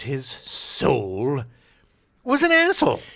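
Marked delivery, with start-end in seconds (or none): 2.2-3.0 exclaimed. Real, not acted. Outburst at Gene Siskel.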